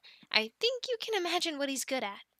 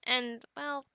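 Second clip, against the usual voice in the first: normal talking voice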